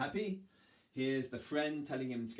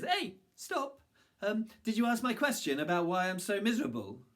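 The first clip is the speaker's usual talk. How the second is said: calling woman; 10 semitones higher, 6 dB louder than usual. Acted. Young woman calls out.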